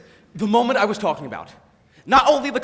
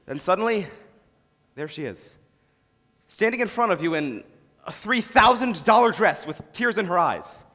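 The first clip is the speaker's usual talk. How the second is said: usual speaking voice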